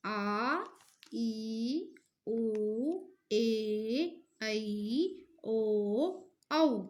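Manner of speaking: each vowel आ, ई, उ held long and spoken apart; dragged-out teacher's vowel demo; long vowels drawn out. Shown as a demonstration. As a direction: sound out the long vowels ई, ऊ, ओ, slowly one by one. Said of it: "अब इनका उच्चारण करके देखिए".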